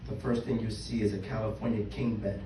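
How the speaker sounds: normal talking voice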